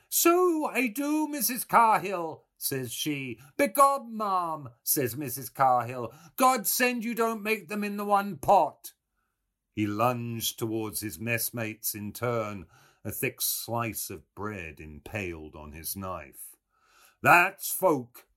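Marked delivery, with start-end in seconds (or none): 0.1-8.9 high, loud mimicry of gossiping old women; wheedling old woman. A narrator's reading. Mulligan acting out Mother Grogan and Missus Cahill, wheedling. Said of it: "went on hewing and wheedling". 17.2-18.3 emphatic, high and loud earnestness; very earnest. A narrator's reading. Mulligan advising Haynes for his book, very earnest. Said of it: "he said very earnestly".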